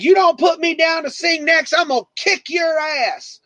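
high, mock menacing regular; threatening drunk. DJ imitating regular's threat, high mock menace. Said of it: "he's like".

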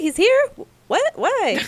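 acted disbelief, high rising questions; surprised. Friend retells his shock at news of [name], surprised. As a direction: jump up in pitch, imitating startled confusion.

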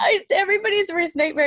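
high, loud, laughing agreement. Real, not acted. Host agreeing with guest about poster nightmare, laughing.

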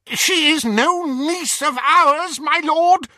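high, loud, desperate protest; crying out. Acted. Bellatrix pleading to her lord over the laughter, crying out. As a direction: cry out over the laughter in shrill panic. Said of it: "she cried over the outpouring of mirth".

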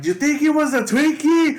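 high, loud incredulous holler; mock yell. Student heckling the officer who ran over, mock yell. Acts out his teasing shout.

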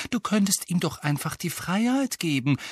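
high, eager suggestion; hopeful. A narrator's reading. Girl suggesting freedom for the elf, hopeful. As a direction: propose it with hope in a lifted voice. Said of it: "sagte Hermine hoffnungsvoll".

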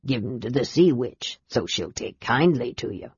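put-on old sailor offering gifts to the knights, gummy and humble; toothless old sailor. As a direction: mumble like a toothless old seaman.

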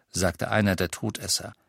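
ordinary delivery

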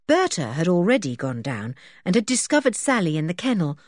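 plain reading voice

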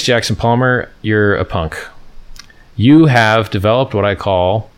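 ordinary talk

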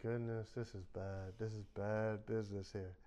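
half-under-breath realisation it's going badly; quiet mock dread; dismayed aside. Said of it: "I'm like".

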